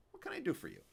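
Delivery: a helpful grandfather; old Yiddish man. A narrator's reading. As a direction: Fischel offering his service. Genie offers help.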